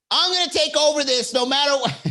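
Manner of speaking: high, mock-domineering yell; high-pitched overbearing outburst; imitated yelling. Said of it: "I've been yelled at before".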